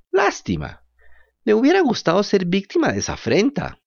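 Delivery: high, odd puppet voice; Pinocho's voice. Acted. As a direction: play the naive puppet, high. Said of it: "dijo Pinocho". (voz aguda de marioneta)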